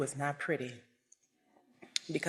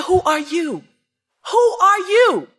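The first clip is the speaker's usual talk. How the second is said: loud yell, building higher and louder; father yelling; 11 semitones higher, 11 dB louder than usual. Retold or imitated. Father yelling at his son, escalating loud shout. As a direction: shout it down, rising each time. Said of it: "This is how he said it too".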